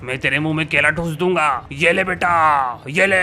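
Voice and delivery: deep, low evil criminal voice; menacing villain. Acted. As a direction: growl out threats like a killer. Villain threatens guard.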